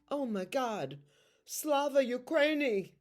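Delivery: rising high with emotion on Slava Ukraini; exclaiming to the patient, overcome; moved exclamation. Retold or imitated. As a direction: burst out with heartfelt solidarity.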